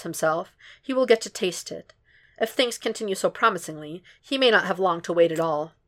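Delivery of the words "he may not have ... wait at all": usual speaking voice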